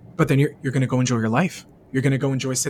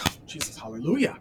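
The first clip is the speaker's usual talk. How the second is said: hushed, softer mock relief; breathy relieved whisper; 6 dB quieter than usual. Imagined person hearing help is coming, hushed relief. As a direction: sigh it out like a weight just lifted.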